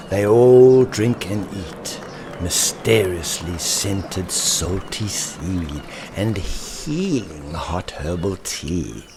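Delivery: ordinary delivery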